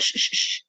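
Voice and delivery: sharp, soft repeated shush. Acted. Judge hushing the defendant in court, soft shushing. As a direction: hush him with three quick shushes.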